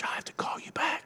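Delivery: discreet whisper into a phone; hushed whisper. Acted. Preacher acting a polite churchgoer on the phone, whispered. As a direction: whisper it so nobody around hears. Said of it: "he wasn't quiet about it. He wasn't like".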